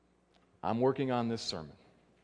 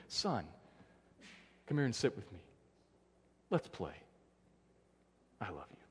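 softer, fading gentle father's invitation; tender fatherly voice; 6 semitones lower, 9 dB quieter than usual. Retold or imitated. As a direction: hush down, loving father calling his son. God speaking tenderly to his son.

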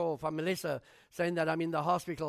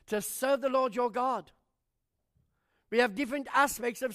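pitched up, pressing the congregation; rising preaching; 5 semitones higher, 3 dB louder than usual. Acted. Pastor urging the church to serve openly, fervent preaching. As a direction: preach it, lifting each question.

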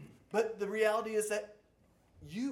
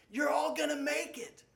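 higher, beaming mock cheer; cheery optimist; 8 semitones higher, 4 dB louder than usual. Preacher mocking Paul as a grinning optimist, higher beaming cheer. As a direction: sell it with a great big grin. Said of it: "with a great big smile".

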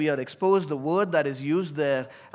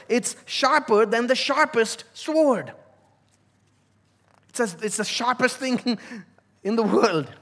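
louder, higher, driving the point home; preaching at full force; 5 semitones higher, 5 dB louder than usual. Acted. Pastor stressing God's word to congregation, full-force preaching. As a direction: preach it, building on each sharper. Preaching climax on sharp sword.

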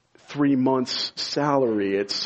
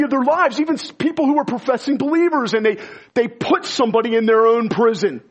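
higher, louder impassioned sermon; preaching at full force; 6 semitones higher, 4 dB louder than usual. Acted. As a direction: press the warning home with fire. Preaching on unforgiveness.

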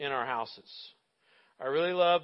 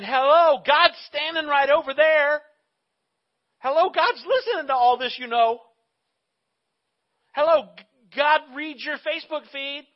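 high, loud, incredulous calling out; loud wake-up call; 9 semitones higher, 11 dB louder than usual. Acted. Preacher voicing James warning grumblers, loud exasperated wake-up call. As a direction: call out loudly as if stating the obvious.

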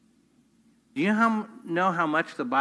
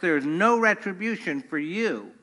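normal talking voice

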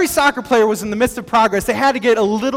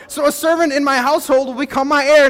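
mildly pushy, raised protest; impatient complaint; 6 semitones higher, 4 dB louder than usual. Acted. Abram pressing God about his heir, acted impatient complaint. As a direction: press the grievance with a touch of impatience. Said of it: "he's being a little impatient".

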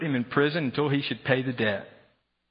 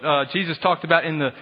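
ordinary talk

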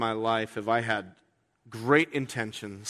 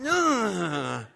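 laughing groan; 5 semitones higher than usual. Real, not acted. Groan of failure.

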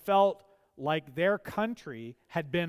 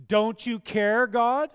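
"Don't you care, God?" cried out louder and higher; loud plea to God; 5 semitones higher, 7 dB louder, slower than usual. Retold or imitated. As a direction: voice the prayer nobody dares say aloud. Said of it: "You don't come out and say that".

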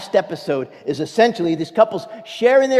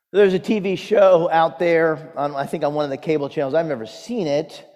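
usual speaking voice

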